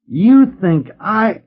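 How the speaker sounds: strangled, high and slow, words catching; choking on his own outrage; choked. A narrator's reading. Rick choking out his shock at the old ham. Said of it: "he choked".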